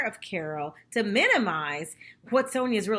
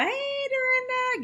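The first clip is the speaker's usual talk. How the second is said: much higher, slow sweet hello; sing-song greeting; slower than usual. Retold or imitated. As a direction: stretch out a syrupy high hello. Sonja greets Dorinda sweetly.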